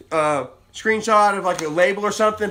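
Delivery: ordinary talk, nothing marked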